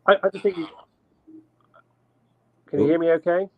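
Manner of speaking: glitchy, warbling call audio; breaking up on a bad call; wobbly warbling call audio. Real, not acted. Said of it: "Yeah, you're glitching out a bit".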